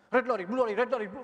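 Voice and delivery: fast twister demo. Shown as a demonstration. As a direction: model the right order at speed. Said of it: "is incorrect".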